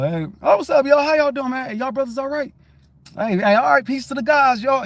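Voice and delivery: buddy-buddy shout-out; hearty greeting. Retold or imitated. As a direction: call out cheerfully to the guys like old friends. Acts out greeting the guys.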